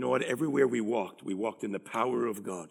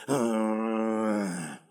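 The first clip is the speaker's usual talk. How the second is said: reluctant groan; 3 dB louder than usual. Acted. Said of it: "that flesh says".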